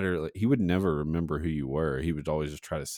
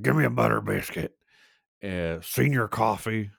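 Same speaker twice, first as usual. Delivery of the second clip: higher, put-on old-man voice; imitating old regular; 3 semitones higher than usual. Old man ordering breakfast at Hardee's, droopy-mouthed impression. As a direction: order out of the corner of your mouth. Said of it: "he talked like this".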